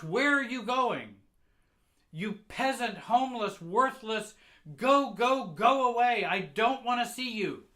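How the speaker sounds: high, very loud screaming; mother shouting. Retold or imitated. Mother screaming at her child to go away, very loud shout. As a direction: scream at them to get out. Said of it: "She shouts".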